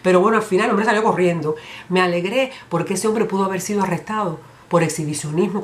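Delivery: ordinary talk, nothing marked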